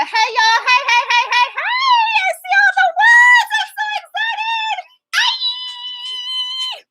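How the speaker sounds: very high, loud squealing greeting with laughter; excited squeal. Real, not acted. Host greeting her sisters in the live chat, squealing with excitement.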